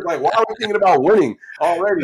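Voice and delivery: a fan pleading to savor the win, loud and high; excited protest. Retold or imitated. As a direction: burst out like a thrilled fan who can't believe the question. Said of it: "I'm like".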